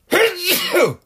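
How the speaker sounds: sudden loud sneeze; real sneeze, loud burst; sneezing. Real, not acted. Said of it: "I have to sneeze on that one".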